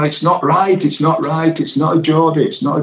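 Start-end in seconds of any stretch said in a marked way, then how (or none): 0.0-1.6 raised, pitched-up quoting of Humble; lightly voiced caller insisting; high-pitched retold protest. Retold Humble's phone call. 1.6-2.5 pitched up, insistent quoting; raised imitation of the caller; high-pitched retold quote.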